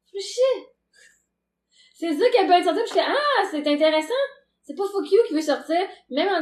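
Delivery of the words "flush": "Flush" said short and high, breathy rising; a quick high "Flush" popping out; flush sound. Shown as a demonstration. Recalling the word that escaped, short high "Flush". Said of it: "mais le son flush".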